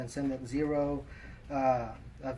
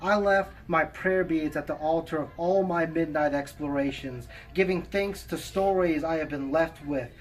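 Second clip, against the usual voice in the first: poem recitation; 3 semitones higher, 5 dB louder than usual. Acted. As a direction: recite it with measured pauses between phrases. Reads own poem aloud.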